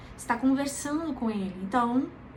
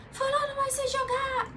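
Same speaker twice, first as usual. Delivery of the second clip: high, panicked cry; desperate shriek. Shown as a demonstration. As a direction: cry out in despair, much higher. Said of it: "E, e desesperado". (grito desesperado e agudo)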